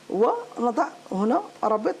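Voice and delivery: ordinary talk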